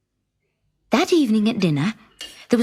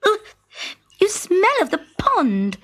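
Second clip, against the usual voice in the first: disgusted; 9 semitones higher than usual. Acted. Princess disgusted.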